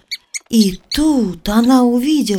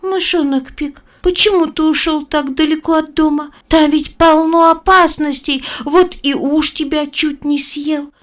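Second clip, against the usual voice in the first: put-on little girl voice; 8 semitones higher, 4 dB louder than usual. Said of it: "и сказала".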